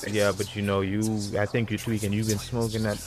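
ordinary talk, nothing marked

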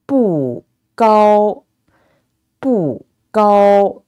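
"不" falls, then "高" stays high and level; fourth-tone bu. Shown as a demonstration. Teacher giving a phrase to repeat, "不" falling, "高" high. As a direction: drop "不", sustain a high flat "高". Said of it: "bu, with the fourth tone".

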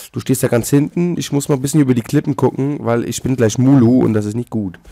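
ordinary talk, nothing marked

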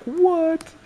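very high, loud squawk of shock; mock disbelief. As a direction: imitate a stunned opponent, pitch shooting way up. Retells opponent's shock at the card.

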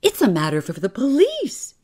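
high, loud outburst of alarm. A narrator's reading. Clerk warning his employer of police, alarmed. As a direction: blurt it out, pitched right up. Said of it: "he said in alarm".

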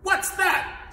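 high, loud, startled snap; snapped. Acted. Turtle king hears a faint sigh below, snapping. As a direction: snap it out, pitch leaping up. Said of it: "snapped the king".

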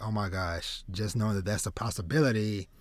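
ordinary talk, nothing marked

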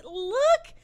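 sudden loud, high "look!"; excited squeal. Real, not acted. Suddenly spots the cute character.

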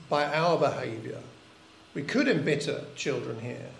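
ordinary talk

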